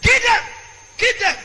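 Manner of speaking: three yelled commands, high and loud; shouted kill order. Retold or imitated. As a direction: bellow each "kill them" like an officer.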